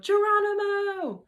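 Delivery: high, loud battle cry; yelled. A narrator's reading. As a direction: fling it out as you launch down the slide. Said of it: "and yelled".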